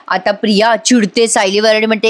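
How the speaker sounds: normal talking voice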